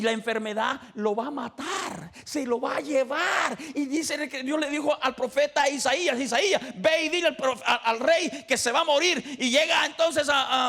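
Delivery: loud, high storytelling from the pulpit; fervent preaching. Acted. Preacher telling story at full force.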